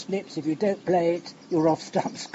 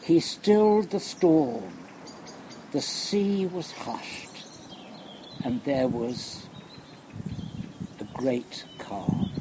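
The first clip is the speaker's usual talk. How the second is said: hushed scripture reading; 2 semitones lower, slower than usual. Acted. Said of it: "Listen to this".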